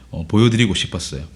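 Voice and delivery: usual speaking voice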